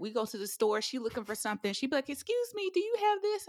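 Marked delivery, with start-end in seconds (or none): 2.1-3.5 put-on high-pitched child's polite question; sociable niece. Niece asking a store worker, high imitation. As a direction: pitch it up like a forward little girl.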